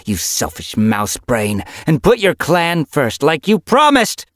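loud, raging scold; angry. A narrator's reading. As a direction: spit it out in fury. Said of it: "scent rage pulsing from her".

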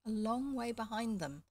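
ordinary delivery, nothing marked

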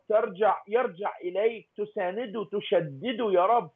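ordinary delivery

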